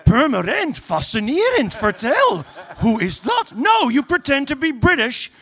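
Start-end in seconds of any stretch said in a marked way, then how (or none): 0.1-3.5 louder, fake-enthusiastic Dutch small talk; mock eager Dutch tourist; chatty Dutch tourist. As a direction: gush at a fellow Dutch couple. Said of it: "go up to them and say".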